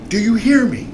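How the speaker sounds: high-pitched mock yell, not full volume; retold screaming. Officer demanding the driver answer him, high-pitched mock scream. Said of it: "he's screaming".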